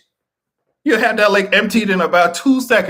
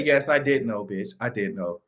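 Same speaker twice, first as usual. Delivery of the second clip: lower, much softer sheepish confession; mock guilty admission; 10 semitones lower, 8 dB quieter than usual. Pogo admitting he knew, low and hushed. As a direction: drop the voice to a guilty mumble. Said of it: "that annoying ass talking monkey say".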